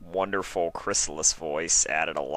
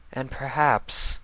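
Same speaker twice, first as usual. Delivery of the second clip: considering; 6 semitones higher, slower than usual. A narrator's reading. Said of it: "she considered".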